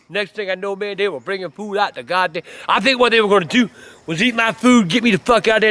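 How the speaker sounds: ordinary delivery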